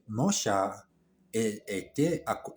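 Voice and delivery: ordinary delivery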